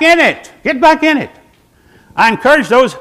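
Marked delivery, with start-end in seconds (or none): 0.0-1.3 urgent exhortation. Real, not acted. Preacher urging return to ship.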